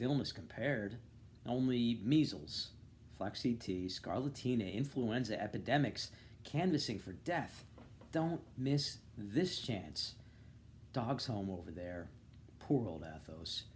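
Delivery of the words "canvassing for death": ordinary delivery, nothing marked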